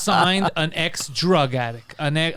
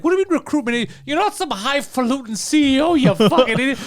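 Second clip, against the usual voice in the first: higher, louder mock rant; retold yelling; 8 semitones higher, 4 dB louder than usual. The friend yelling at Adam over recruitment, higher angry rant. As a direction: bark at him like a furious friend. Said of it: "So he starts yelling".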